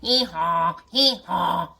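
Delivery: loud braying hee-haw; donkey bray. Acted. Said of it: "That's how a baby donkey sounds".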